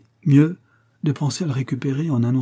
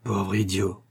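open contempt; 3 dB quieter, slower than usual. A narrator's reading. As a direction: drop it with quiet disdain. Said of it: "avec un mépris non dissimulé". (mépris non dissimulé, sans hausser le ton)

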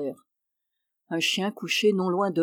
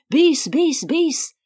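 high, loud delighted calls of bis; crowd shouting bis; 7 semitones higher, 5 dB louder, much slower than usual. A narrator's reading. The delighted audience shouts for an encore, loud and high. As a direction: cheer it like a thrilled audience. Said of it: "l'auditoire, ravi, criait".

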